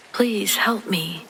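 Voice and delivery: a monster faking a cry for help; creepy fake high voice. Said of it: "trying to use a high pitch tone".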